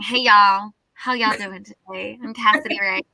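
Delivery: imitated American y'all greeting; American accent. Singer walking off the ship, American accent. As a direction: slip into a folksy American accent. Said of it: "y'all became quite an habitual part".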